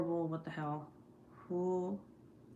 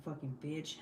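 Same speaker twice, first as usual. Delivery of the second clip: muttered insult; 2 semitones lower, 6 dB quieter than usual. Retold or imitated. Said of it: "and I'm like".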